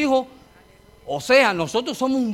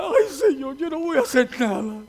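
retold crying; 6 semitones higher than usual. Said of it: "Y empecé a llorar".

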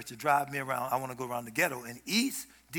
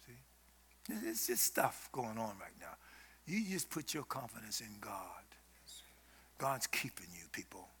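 dropped to a near-whisper, much softer; hushed whisper; 7 semitones lower, 12 dB quieter than usual. Real, not acted. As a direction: lean in and breathe it to them.

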